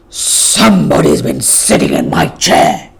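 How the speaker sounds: gruff big bear. Acted. Great huge bear growling about his chair, low and loud. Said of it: "growled in a big gruff voice".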